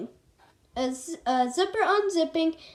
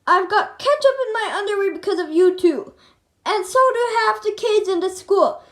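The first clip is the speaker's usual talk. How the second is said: fast, loud, higher angry rant; furious yelling; 6 semitones higher, 7 dB louder than usual. A narrator's reading. Principal yelling at the boys over the ketchup, fast furious rant. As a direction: tear into them, fast and loud.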